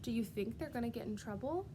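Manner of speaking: ordinary talk, nothing marked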